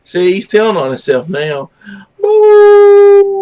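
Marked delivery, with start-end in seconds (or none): 2.2-3.4 long, loud high howl; imitated dog howl, held on one note. Shown as a demonstration. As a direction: howl like a dog to get it joining in. Howling along with the dog.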